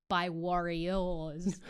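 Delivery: "warriors" said very slowly, war stressed; slow "warriors" with war punched. Shown as a demonstration. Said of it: "which is the war ones".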